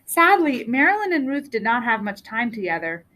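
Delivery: ordinary delivery, nothing marked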